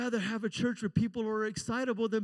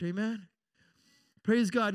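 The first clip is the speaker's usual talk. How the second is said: normal talking voice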